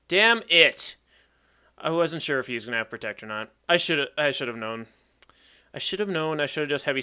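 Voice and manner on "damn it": loud, higher, emphatic curse; frustrated outburst. Real, not acted. Player cursing a misplay, frustrated outburst. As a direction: let the curse burst out louder.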